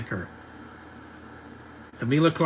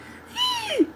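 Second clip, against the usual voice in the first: very high imitated squeak; puberty voice squeak. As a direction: mimic a cracking adolescent squeal. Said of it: "His voice squeaks so much".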